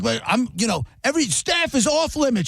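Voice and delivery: high, fast mock rant from the riled-up manager; imitating an angry boss. Manager yelling at the host on the phone, high fast mock rant. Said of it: "He's like".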